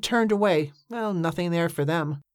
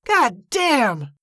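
high, frustrated swearing; shouted curse; 7 semitones higher, 3 dB louder than usual. A narrator's reading. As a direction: curse hard, pitch dropping on each word. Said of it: "he shouted".

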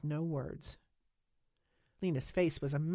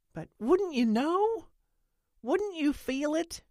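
much higher, louder, slow disbelief; incredulous pleading; 12 semitones higher, 8 dB louder, slower than usual. A narrator's reading. Nance challenges Lena's alien pregnancy, high and slow. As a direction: press her with wide disbelief.

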